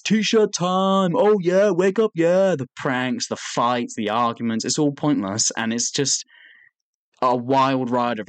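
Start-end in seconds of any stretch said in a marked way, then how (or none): 0.0-2.5 high, loud mock hype call; catchphrase shouting. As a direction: chant them out like a rousing catchphrase. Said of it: "the funny catchphrases".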